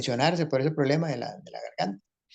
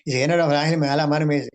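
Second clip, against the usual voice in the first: ordinary delivery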